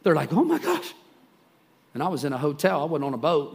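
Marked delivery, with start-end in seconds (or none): 0.3-0.9 high-pitched mock fright; hammed-up frightened exclamation; scared to death. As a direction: imitate a panicked gasp of alarm, pitched way up. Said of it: "these people are scared to death".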